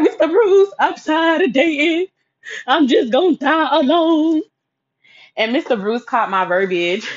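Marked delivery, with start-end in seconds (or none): 0.0-4.4 high, loud, slow self-pitying wail; melodramatic whine. Retold or imitated. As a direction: ham up the despair, dragging each word. Dramatic self-pity to mentor.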